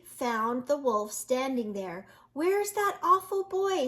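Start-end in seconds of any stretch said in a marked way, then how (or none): 2.3-3.9 a high, alarmed pig voice; screamed. A narrator's reading. Missus Pig looking for the boy, high alarmed voice. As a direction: Missus Pig rushing to help. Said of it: "screamed Missus Pig".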